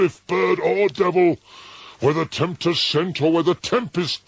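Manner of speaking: high, loud, frantic imploring; desperate plea. A narrator's reading. Narrator begs the raven about Gilead, high and desperate. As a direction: beg the bird for an answer.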